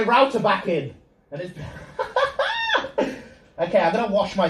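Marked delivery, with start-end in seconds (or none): 1.6-3.1 high, sudden disbelieving laugh; squeaky laugh at his own mistake; embarrassed laugh. Real, not acted. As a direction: burst out laughing at yourself. Realizes router unplugged.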